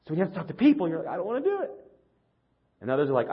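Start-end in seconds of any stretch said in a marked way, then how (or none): 1.1-1.7 whiny high complaint; fussy. Retold or imitated. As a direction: whine like a sulky kid. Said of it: "I sound really fussy".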